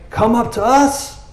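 high, slow taunting call from the hilltop; mock taunt. Philistine guard mocking from the hill, high taunt. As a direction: jeer it up high like an enemy soldier.

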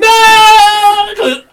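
long, high, loud cry; dismayed scream. Acted. Fake doctor is unmasked, dismayed scream. Impostor exposed.

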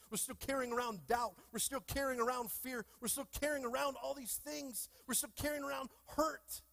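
rising, urgent pulpit build; climbing preacher's cadence; preaching at full force. Acted.

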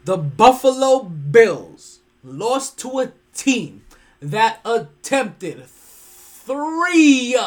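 very slow and loud, "three" stretched out; slow emphatic punchline. Real, not acted. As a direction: spell it out loud and slow, in disbelief. Repeating the punchline for effect.

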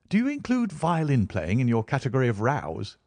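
usual speaking voice

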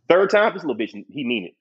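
ordinary delivery

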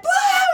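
high startled scream; mock scream. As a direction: yelp in fright at the fireball. Fireball, everyone screams.

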